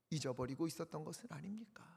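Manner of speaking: a hushed, searching question; whisper. Real, not acted. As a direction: lean in and whisper it.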